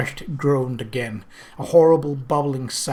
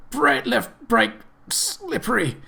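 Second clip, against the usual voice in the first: dying gasp; 10 semitones higher, slower than usual. Acted. Dying man's last words.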